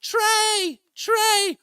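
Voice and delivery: high, loud fangirl shriek of a name; screaming his name. Retold or imitated. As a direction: call out to the singer like a smitten fan. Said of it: "screaming his name".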